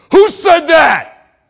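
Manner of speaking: high, very loud, slow demand; thundering challenge to an unseen heckler; angry roar back. Retold or imitated. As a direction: roar it at the whole crowd. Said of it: "roared back".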